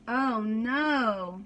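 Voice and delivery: ordinary delivery, nothing marked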